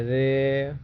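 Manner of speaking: रे with its vowel held long; prolonged pluta vowel. Shown as a demonstration. Teacher showing the vowel of calling out, रे drawn out. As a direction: stretch रे to triple length. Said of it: "प्लुत स्वर का प्रयोग किया जाता है".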